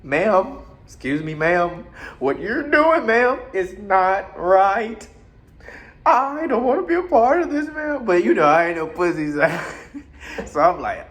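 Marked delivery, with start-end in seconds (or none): 0.0-8.0 mock polite refusal. Mock-prim refusal to woman.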